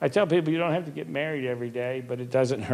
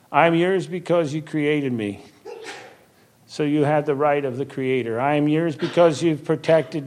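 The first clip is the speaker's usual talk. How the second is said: heartfelt prayer; 5 dB louder than usual. Acted. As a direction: pray it aloud as a personal vow. Recites his daily prayer.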